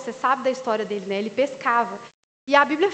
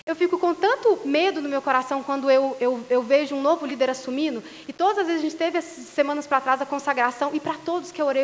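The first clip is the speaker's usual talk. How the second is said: normal talking voice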